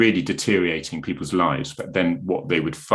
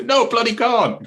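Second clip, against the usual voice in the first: much higher, louder scoffing denial; mock indignant retort; 6 dB louder than usual. Retelling people who tease the Cornish, high mock scoff. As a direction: fire it back like an outraged local. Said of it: "and they say".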